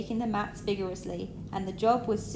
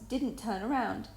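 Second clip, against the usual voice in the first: ordinary delivery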